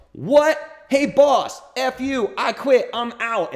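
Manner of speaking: high, loud burst of mock joy; ecstatic quitting barista. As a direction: shriek it like you just won the lottery. Barista quits after huge tip.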